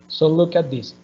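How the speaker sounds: usual speaking voice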